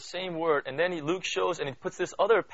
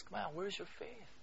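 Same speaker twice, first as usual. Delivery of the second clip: soft coaxing; 4 semitones higher, 9 dB quieter than usual. Retold or imitated. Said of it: "the Lord always looks and says".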